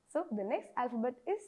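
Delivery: ordinary talk, nothing marked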